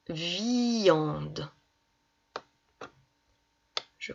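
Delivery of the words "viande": "viande" slowly, i held long before the nasal an; drawn-out syllable. Shown as a demonstration. Teacher giving an example word for ian, drawn out. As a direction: stretch out the vi-an in "viande". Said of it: "Comme dans".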